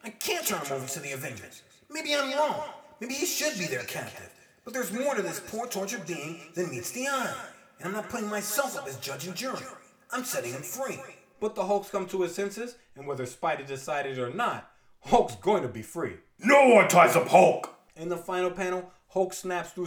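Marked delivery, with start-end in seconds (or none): 0.0-11.0 Spider-Man voice. Acted. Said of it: "He goes on to think". 16.4-17.7 loud, slow monster shout; Hulk roar. Acted. Hulk breaks free, defiant.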